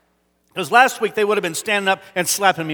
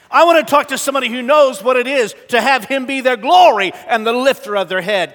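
louder, higher building preaching; rising preaching fervor; 6 semitones higher, 6 dB louder than usual. Real, not acted. Preacher stirs the church with rising passion. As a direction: build each line like a rally cry.

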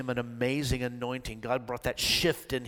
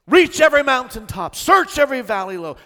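loud, high, soaring preacher's cry; full-force preaching; 8 semitones higher, 15 dB louder than usual. Real, not acted. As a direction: preach it to the rafters, building fervor.

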